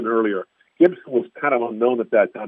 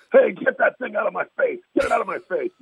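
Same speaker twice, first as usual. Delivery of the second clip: imitating an angry yell; 9 semitones higher than usual. Gibson swats microphone away.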